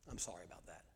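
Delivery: very soft, low contrition; barely voiced humble sorry; hushed apology. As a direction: apologize gently, almost under the breath. Models apologizing to his children.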